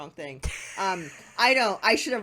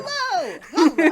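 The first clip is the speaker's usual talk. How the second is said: loud excited hello; 6 semitones higher, 8 dB louder than usual. Real, not acted. Jumping in to volunteer.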